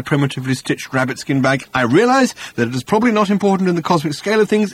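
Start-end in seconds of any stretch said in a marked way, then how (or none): 1.7-4.7 fast, high-pitched justification; huffy defence. Acted. Arthur justifying his towel fuss, huffy. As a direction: rattle it off defensively.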